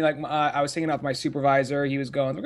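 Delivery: usual speaking voice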